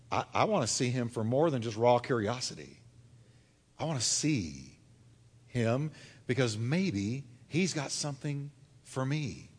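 low, soft, halting hope; hushed inner hunger; quiet longing. Acted. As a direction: confide it low, as a deep wish. Voices Zacchaeus' deeper hunger.

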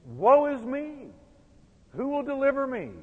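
anguished cry. Retold or imitated. Paul crying out over his sin, anguished. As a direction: cry out like a man at the end of himself. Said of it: "And he said".

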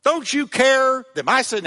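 high, loud indignant complaint; angry Martha. Acted. Martha complaining to Jesus about her sister, angry and loud. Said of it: "But she goes in there and she says".

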